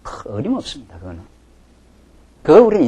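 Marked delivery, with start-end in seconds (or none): 0.0-1.2 scoffing dismissal. Acted. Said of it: "내 인생이 팽강하겠다".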